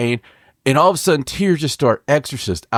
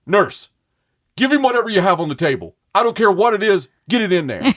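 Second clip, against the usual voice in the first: higher-pitched, urgent mock doctor's orders; doctor flipping out; 7 semitones higher than usual. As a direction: order the nurse around in alarm. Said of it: "the doctor flips out".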